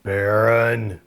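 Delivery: low, distorted scold. Shown as a demonstration. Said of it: "scold him in a low voice".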